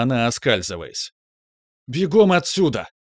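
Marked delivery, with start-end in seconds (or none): 1.9-2.9 high loud yell. A narrator's reading. Kivrin yells at the girl to run. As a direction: bawl the order to run. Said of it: "завопил Киврин".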